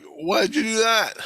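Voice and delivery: high, loud, drawn-out retold question; exasperated high-pitched protest; indignant protest. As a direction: demand an explanation, stretched and high.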